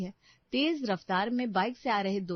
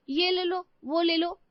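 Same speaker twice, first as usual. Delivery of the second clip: pushy mother voice; 9 semitones higher, 5 dB louder than usual. Retold or imitated. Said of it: "बस देखती".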